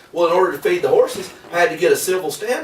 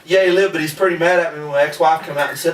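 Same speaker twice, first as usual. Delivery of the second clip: ordinary talk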